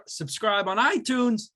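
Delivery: awkward slow sing-song plug; weird delivery. Real, not acted. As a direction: stretch the words oddly by accident. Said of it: "I don't know why I said it like that".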